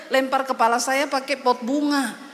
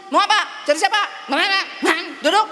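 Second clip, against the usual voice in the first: louder, curt snapping questions; rude woman; 3 semitones higher, 4 dB louder than usual. Retold or imitated. Woman before prayer snapping at the pastor, curt and loud. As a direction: bark at the visitor impatiently.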